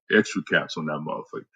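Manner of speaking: ordinary talk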